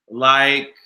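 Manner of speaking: usual speaking voice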